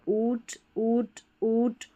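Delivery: ऊट slowly, each with a drawn-out oo; long vowel. Shown as a demonstration. Teacher pointing out examples on the page, long vowel. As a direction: say ऊट, ऊट, ऊट as separate long-vowel sounds. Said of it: "यहाँ पर long उच्चारण रहेगा".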